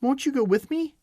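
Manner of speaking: put-on high, pleading girl's question. A narrator's reading. Dorothy pleading with the old witch to come along. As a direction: beg her not to leave. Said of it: "pleaded the girl".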